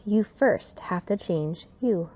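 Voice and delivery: ordinary delivery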